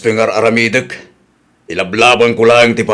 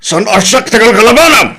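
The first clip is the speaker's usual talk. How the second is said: angry challenge; 11 semitones higher, 5 dB louder than usual. Acted. Quarrel over parking spot.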